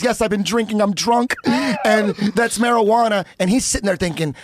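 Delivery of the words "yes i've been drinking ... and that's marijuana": high-pitched mock-tearful admission; imitating a weepy teen confessing to police; retold crying confession. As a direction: whimper a full confession, half in jest. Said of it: "I'm crying, admitting to the cops".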